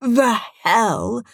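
emphatic, incredulous "The hell"; strongly stressed. A narrator's reading. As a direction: lean heavily on "hell" in disbelief. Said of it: "I can't stress this strongly enough".

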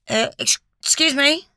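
a dazed, rising excuse me. A narrator's reading. Matt interrupting, dazed and holding his head. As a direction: sound lost and stunned. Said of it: "said Matt dazedly".

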